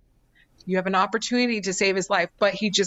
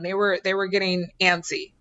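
ordinary talk, nothing marked

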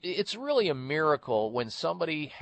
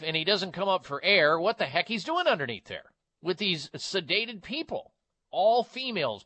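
outraged; 7 semitones higher, 3 dB louder than usual. Real, not acted. Outraged about the surgical drape.

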